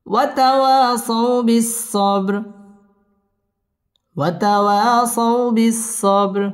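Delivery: slow, drawn-out melodic recitation; Quran recitation. Shown as a demonstration. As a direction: chant it slowly, tajweed style. (ধীর সুরেলা কুরআন তিলাওয়াত)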